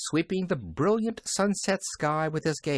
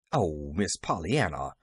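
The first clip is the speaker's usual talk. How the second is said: low, gentle protest; demurring; 6 semitones lower than usual. A narrator's reading. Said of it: "demurred Nancy".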